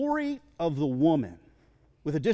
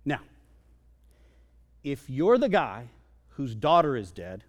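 ordinary talk, nothing marked

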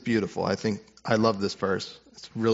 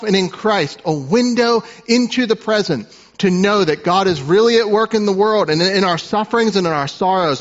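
raised, louder pulpit voice; preaching at full force; 8 semitones higher, 6 dB louder than usual. Acted. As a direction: build the sermon to its climax. Sermon climax.

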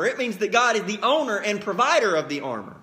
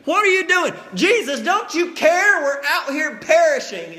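much higher, louder panicked cry; panicked disciples; 6 semitones higher, 5 dB louder than usual. Retold or imitated. Preacher voices disciples crying to sleeping Jesus, panicked. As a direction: shout it over the storm in panic.

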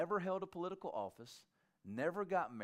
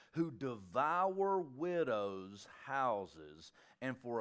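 solemn slow reading; slower than usual. Retold or imitated. Reading Jesus' words solemnly.

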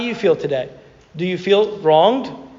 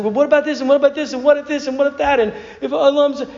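higher, anxious rapid questions; higher mock-anxious teen; panicked questions; 7 semitones higher, faster than usual. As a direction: voice a frantic teen tumbling over worries. Panicked girl questioning, retold.

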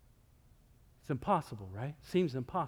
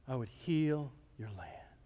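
soft promise; 5 semitones lower, 11 dB quieter than usual. Acted. God's promise voiced softly.

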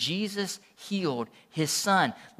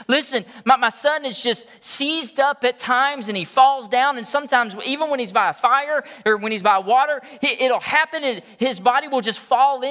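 desperate father; 7 semitones higher, 5 dB louder than usual. Retold or imitated. Desperate father voiced in story.

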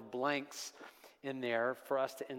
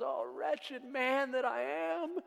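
high, slow anguished lament; anguished cry; 11 semitones higher, slower than usual. Acted. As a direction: cry out as a man at the end of himself. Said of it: "When Paul cries out".

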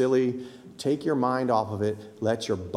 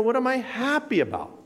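cheerful question; 11 semitones higher than usual. Shown as a demonstration. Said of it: "sometimes it's helpful to say".

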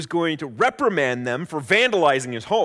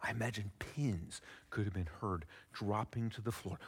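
usual speaking voice

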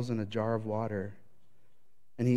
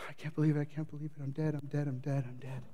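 despairing murmur; 7 dB quieter, faster than usual. Retold or imitated. Elijah panicking, fearing death.

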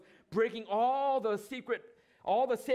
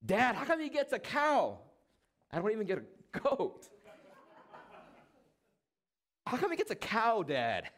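put-on higher, whining child complaint; whiny older son; 4 semitones higher than usual. Older son complains to father about the feast, whining. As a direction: sulk like a kid denied a treat.

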